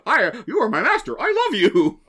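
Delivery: high, gushing talking dog voice; Dug from Up. Retold or imitated. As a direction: squeal it like an adoring cartoon dog. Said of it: "you have the Doug from Up".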